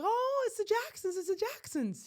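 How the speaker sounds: high-pitched gushing squeal of recognition; excited fan. Retold or imitated. Woman spotting a famous family at the airport, excited. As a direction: imitate a thrilled fan, voice leaping way up. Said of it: "He's all excited".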